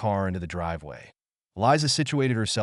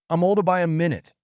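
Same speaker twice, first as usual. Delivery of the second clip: put-on quick, higher, proud child; proud little boy; 8 semitones higher, 4 dB louder, faster than usual. A narrator's reading. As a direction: boast like a small boy.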